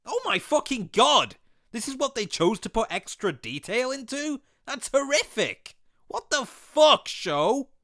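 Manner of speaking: pitched high in appalled disbelief; horrified shock. Real, not acted. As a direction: recoil at something hideous, voice jumping up. Horrified by a puppet's face.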